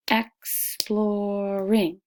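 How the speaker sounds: Exploring stretched slowly, beat per syllable; slow sounded-out Exploring. Shown as a demonstration. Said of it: "Try it again".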